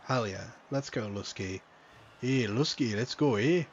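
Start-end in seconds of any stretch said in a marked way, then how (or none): 2.2-3.6 loud Canadian cheer, eh; louder, hammed-up Canadian accent. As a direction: cheer him on like a hockey-loving Canadian. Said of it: "in my Canadian accent".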